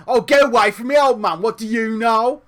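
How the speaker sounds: loud, acted brush-off; mock-indignant youngster rejecting advice; dismissive retort. Younger self snapping at time-travelling older self, loud mock dismissal.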